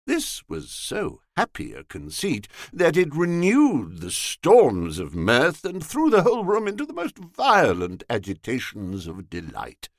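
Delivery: ordinary delivery, nothing marked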